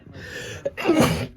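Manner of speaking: sudden real cough; harsh allergy cough. Real, not acted. Allergy cough mid-video.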